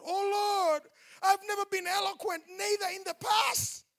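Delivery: Moses crying out loud and high; anguished Moses. Acted. Preacher voicing Moses protesting to God, loud anguished cry. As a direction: wail it high like a desperate Moses.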